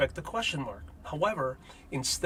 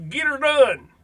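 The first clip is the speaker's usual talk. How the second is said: Larry the Cable Guy catchphrase; 11 semitones higher, 10 dB louder than usual. Retold or imitated. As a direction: holler it like a country comic. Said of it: "really translates to".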